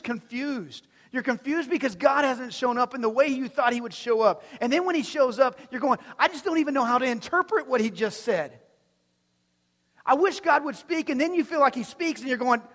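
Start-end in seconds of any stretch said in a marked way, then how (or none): 6.2-11.1 higher and loud, exasperated; frustrated believer. Retold or imitated. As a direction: vent confusion at God. Believer's confused inner voice.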